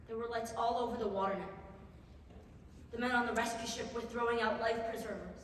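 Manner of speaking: soft, low, numb aftermath; drained recollection. Acted. Survivor recalling being pulled from the sea, drained and quiet. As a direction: let the voice fall away, exhausted.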